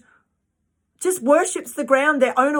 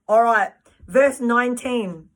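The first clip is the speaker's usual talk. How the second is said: normal talking voice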